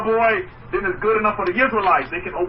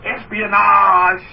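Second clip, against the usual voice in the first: espionage stretched slow and loud; drawn-out, loud espionage; 6 dB louder, much slower than usual. Real, not acted. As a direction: savour espionage, dragging it out. Listing movie thrills.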